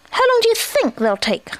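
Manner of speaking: high, impatient little voice; impatient young Roo. A narrator's reading. Roo asks how long sticks will take, impatiently. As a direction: pipe the question up high.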